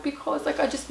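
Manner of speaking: high, soft imitation of crying; tearful. Traveller crying to airport security, imitated tearful protest. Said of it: "I'm crying".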